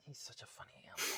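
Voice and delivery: quick unvoiced whisper; whispered aside about the dog. Real, not acted. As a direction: hush it to yourself.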